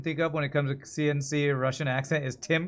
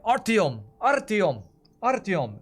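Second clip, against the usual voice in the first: imitating the game's Russian voices calling Artyom, higher; Russian-accented name call; 6 semitones higher, 5 dB louder, slower than usual. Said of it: "oh, they say it".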